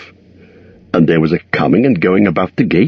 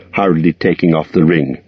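plain reading voice

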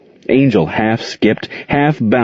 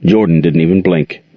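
usual speaking voice

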